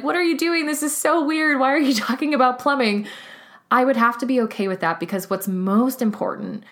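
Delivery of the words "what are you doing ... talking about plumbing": higher, puzzled mock voice; lightly imitating baffled followers; confused followers. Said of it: "everybody was so confused and was like".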